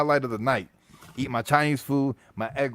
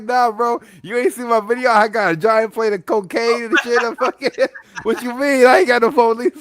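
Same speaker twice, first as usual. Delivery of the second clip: high, loud mock of his friend's brag; 11 semitones higher, 6 dB louder than usual. Teasing Chris by imitating his brag, high and loud. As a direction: ham up your buddy bragging about his video. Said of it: "He's like".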